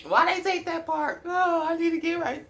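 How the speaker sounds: a lightly hammed-up high whine; mock distressed self. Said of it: "I'm like".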